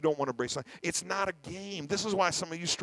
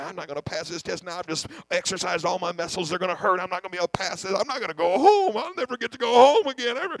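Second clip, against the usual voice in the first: higher, louder, half-laughing panic; frantic worrying, laughing at the fear; panicked self-talk; 4 semitones higher, 7 dB louder than usual. Retold or imitated. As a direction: spiral into comic despair.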